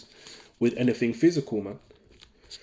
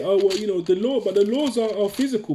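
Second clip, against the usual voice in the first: higher-pitched voice of someone objecting; imagined objector; 9 semitones higher than usual. Acted. Preacher mimicking someone who might argue back, higher-pitched objection. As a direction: put on a doubter's voice, pitched up. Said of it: "And someone might say".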